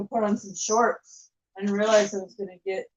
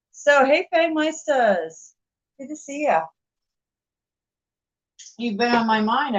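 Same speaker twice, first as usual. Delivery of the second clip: excited greeting; 5 semitones higher, 5 dB louder than usual. Real, not acted. Greeting a returning viewer warmly.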